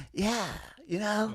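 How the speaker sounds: high, soft mock raspy girl voice; imitated raspy voice. Mimicking the contestant's annoying voice, raspy and high. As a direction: imitate a girl who is losing her voice. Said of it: "it's so raspy, and it feels like".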